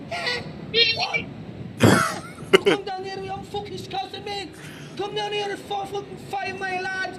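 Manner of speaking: very high-pitched, threatening Scouser; exaggerated Scouse. Shown as a demonstration. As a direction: squeal out menacing Liverpool slang. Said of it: "So here's, I think, a Scouser".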